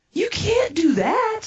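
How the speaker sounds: very high, drawn-out disbelief; reenacted high-pitched dismay; appalled protest. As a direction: plead against it in shock. Protests shocking news.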